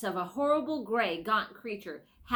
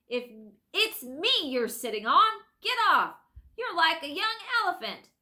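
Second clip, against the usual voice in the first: angry; 9 semitones higher, 6 dB louder than usual. Acted. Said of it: "came an angry voice".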